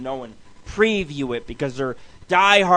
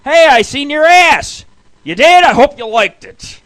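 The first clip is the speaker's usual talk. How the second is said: mocking yell; 9 semitones higher, 11 dB louder than usual. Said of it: "They're like".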